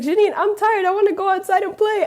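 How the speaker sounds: high, mock kid voice whining; whiny little brother. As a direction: play the tired kid brother begging to go play. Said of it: "he's like".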